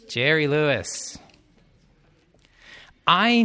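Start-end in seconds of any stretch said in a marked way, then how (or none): none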